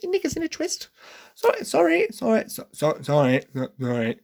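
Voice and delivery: squeaky high, flustered sorry; hammed-up high squeak, apologising over and over; mock flustered apology. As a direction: babble sorry in a high silly voice.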